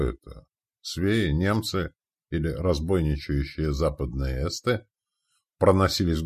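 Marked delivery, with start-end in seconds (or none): none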